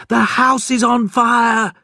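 high, loud cry of alarm; alarmed shout. A narrator's reading. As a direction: raise the alarm through the house. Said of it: "she shouted".

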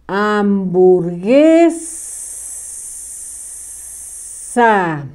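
"Hamburguesa" stretched very slowly, each sound drawn out; slow dictation. Shown as a demonstration. Teacher dictating the word to pupils, very slow. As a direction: spell "Hamburguesa" out by ear, very slow. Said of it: "se le escribe una letra en medio que no suena".

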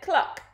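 short, high hen cluck. A narrator's reading. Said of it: "and the hen said".

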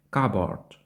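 "Cupboard" said KAH-bord, no P; silent P. Shown as a demonstration. Teacher repeats the word for learners, silent P. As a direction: say "Cupboard" with P dropped entirely. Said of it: "буква P не вимовляється".

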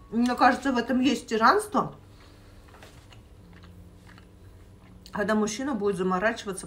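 speaking between bites; talking while chewing. Real, not acted. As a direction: mouth partly full of food. Seen 1: Bites before; cheeks bulge, chewing while talking, slice held near mouth.

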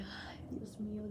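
soft, slow whispered plea; whispered panic. Retold or imitated. Wheeled to the operating room, whispering in fear. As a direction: breathe it out in dread.

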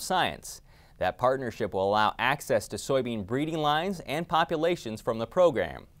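lively, measured farm-report narration; polished announcer delivery with lifted key words; scripted news read. Acted. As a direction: read it like a radio feature intro. Scripted radio report introduction.

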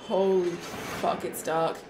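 usual speaking voice

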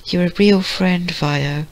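high, soft little pony voice; small high-pitched voice, calmed after crying; Fluttershy. Acted. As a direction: speak softly up high, sweet and grateful. Fluttershy calming down, voiced in story.

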